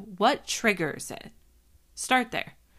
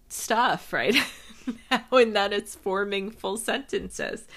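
laughing. Real, not acted. Laughs at own tiredness.

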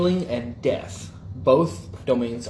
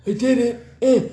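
put-on grumpy old man; 10 semitones higher, 5 dB louder than usual. Old monk voiced.

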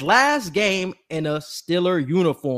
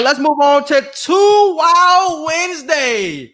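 slow, louder announcer call; segment announcer; 8 semitones higher, 4 dB louder, much slower than usual. Acted. Host kicking off Two Wild Wednesday, slow announcer voice.

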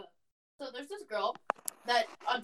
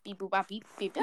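softer robot beeps; 4 semitones lower, 9 dB quieter than usual. Shown as a demonstration. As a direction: quietly beep like a robot. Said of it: "like, is a robot".

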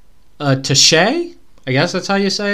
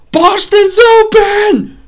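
even louder, slower, higher repeat of the call; all-out excited yell; full shout; 7 dB louder, much slower than usual. Retold or imitated. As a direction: bellow it so he can't miss it. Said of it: "somebody get this to him and say".

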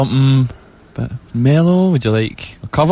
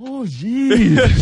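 mock dismay; 8 semitones higher than usual. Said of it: "And I was like".

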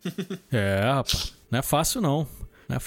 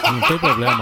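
laughing; 7 semitones higher, 4 dB louder than usual. Real, not acted. Laughing while joking about protection.

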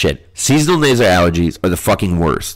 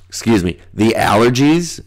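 normal talking voice

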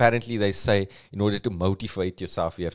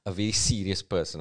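usual speaking voice

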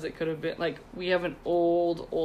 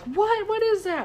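put-on high, excited little-kid voice; imitating the kids' squeals; squeaky kid voice; 13 semitones higher than usual. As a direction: pipe up like a curious child. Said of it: "they're like".

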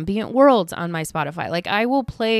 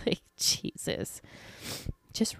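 whispered aside; 4 semitones higher, 13 dB quieter, slower than usual. Real, not acted. Exasperated aside after a rant.

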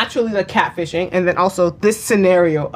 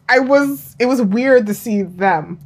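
usual speaking voice

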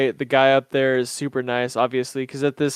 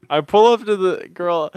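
ordinary talk, nothing marked

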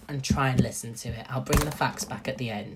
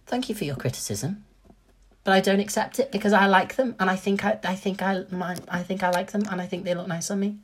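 mock polite decline; 6 semitones higher, 3 dB louder than usual. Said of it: "Then I'd be like".

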